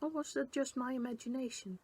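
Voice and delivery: plain reading voice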